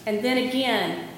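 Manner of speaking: usual speaking voice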